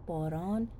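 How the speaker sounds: ordinary delivery, nothing marked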